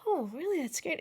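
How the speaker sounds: high, fake-bright sarcastic reply; mock enthusiasm. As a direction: pretend to be impressed, pitched high. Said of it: "And I'm just like".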